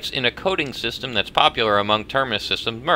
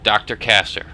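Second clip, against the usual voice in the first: normal talking voice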